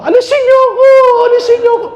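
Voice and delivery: high, loud frantic shout for help; panicked cry. Retold or imitated. Priest imitating a mourner who fell into the grave, panicked shout. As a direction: yell in panic from the pit. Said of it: "Narinig mo yung tao".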